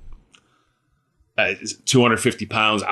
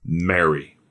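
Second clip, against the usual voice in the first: tender calling; 5 semitones lower than usual. Acted. Jesus calling Mary by name, low and tender. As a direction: say her name gently, like a loving call.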